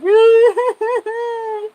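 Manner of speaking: high, loud whoop; mock cheer. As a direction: whoop like a fan through the park gates. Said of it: "We're gonna be all cheering".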